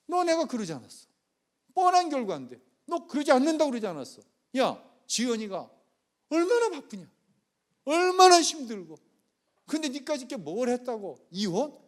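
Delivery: loud, high, scolding outburst at the son; father's furious cursing. Retold or imitated. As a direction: roar at your son like an enraged father. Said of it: "그러면서 막 욕지거리 하는 거야".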